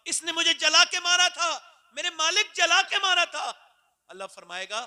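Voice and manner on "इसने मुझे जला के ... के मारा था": high, loud wailing complaint; anguished accusation. Acted. Mother crying out to God against her son, loud and pained. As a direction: cry out the injustice to God.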